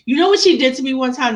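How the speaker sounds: ordinary talk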